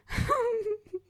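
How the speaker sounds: high squealing burst of laughter. Real, not acted. Laughing about her friend's teenage attitude, a high squeal of laughter.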